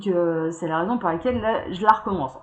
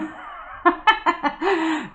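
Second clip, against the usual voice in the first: high-pitched real laughing fit; burst of laughter; 8 semitones higher than usual. Real, not acted. Tarot reader amused by her daughter turning up, laughing. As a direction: break up laughing.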